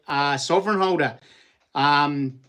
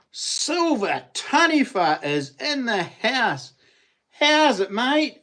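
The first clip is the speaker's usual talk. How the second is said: excited greeting; 7 semitones higher, slower than usual. Real, not acted. Greeting an old friend in chat.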